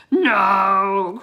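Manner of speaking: a loud wailing No; cry. Acted. Wizard refusing Annie, crying out. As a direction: cry it out in despair. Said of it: "cried the wizard".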